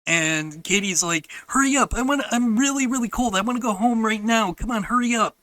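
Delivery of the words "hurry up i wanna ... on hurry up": higher, mimicked demanding child; impatient pestering. Sister hurrying Grandpa home, impatient mimicked nagging. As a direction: imitate a kid nagging to leave.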